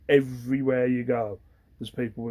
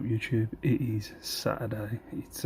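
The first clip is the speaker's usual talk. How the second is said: usual speaking voice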